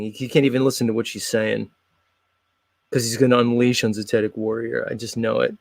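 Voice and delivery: ordinary delivery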